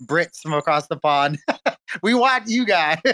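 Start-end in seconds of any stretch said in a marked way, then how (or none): 1.4-2.0 a quick bright burst of laughter; short laugh. Real, not acted. Host laughing at his own Brits joke, short laugh.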